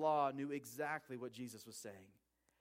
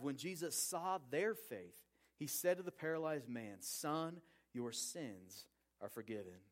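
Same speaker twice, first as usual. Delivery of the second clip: slow and soft, the last words much softer; slow scripture reading; 2 semitones lower, 4 dB quieter, slower than usual. A narrator's reading. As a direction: read the verse gently, letting it settle.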